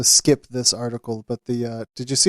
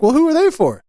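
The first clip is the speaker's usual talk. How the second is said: put-on high little girl voice, louder; 12 semitones higher, 5 dB louder than usual. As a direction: ask it like a small curious child. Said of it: "And like".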